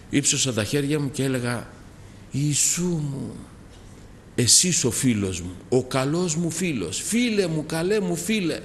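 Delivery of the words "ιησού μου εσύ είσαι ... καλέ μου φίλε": high, pleading cries of "friend"; calling out to Jesus. Retold or imitated. Young man praying aloud at home, crying out. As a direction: cry out to your dear friend, voice lifting. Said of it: "να φωνάζω".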